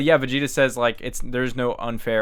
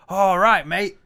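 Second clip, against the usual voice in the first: put-on Australian accent; 8 semitones higher, 6 dB louder, much slower than usual. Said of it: "He's like".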